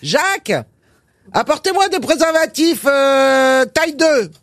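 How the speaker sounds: shouting to the back room. A narrator's reading. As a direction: yell it toward the stockroom. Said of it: "elle crie à son préparateur". (pharmacienne qui crie au préparateur)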